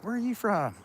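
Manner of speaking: high, loud mock question from school kids; mock classmates asking. Recalling high school classmates, high mock question. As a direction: toss it up high like nosy classmates. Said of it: "and, uh, like".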